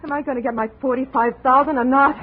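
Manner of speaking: angry demand. Acted. Andrea shouts her demand for money at Jake. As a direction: bark the demand at gunpoint.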